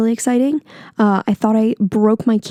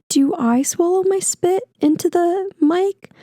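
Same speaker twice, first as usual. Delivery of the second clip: mock-worried higher-pitched question; self-conscious; 8 semitones higher than usual. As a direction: imitate your own self-conscious fretting, slow and high. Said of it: "I got so self-conscious".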